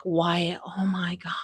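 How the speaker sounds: mock hushed whisper breaking news. James's dad whispering to Wyatt, hushed. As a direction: lean in and whisper it. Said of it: "and is like".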